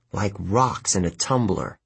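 ordinary delivery, nothing marked

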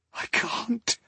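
soft, high trembling woman's whisper; frightened whisper. Acted. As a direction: breathe it out in panic. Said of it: "she whispered".

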